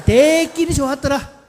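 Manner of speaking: high, loud Gyeongsang exclamation; emphatic, slow Gyeongsang dialect demo. Shown as a demonstration. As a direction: stretch 대끼리 in Gyeongsang style. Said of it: "경상도 버전".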